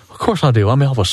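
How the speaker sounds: lightly voiced [name] telling his lunch; a hint of [name] talking to the comedian; [name] impression. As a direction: play the King, deadpan. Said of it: "I'm in full [name] gear".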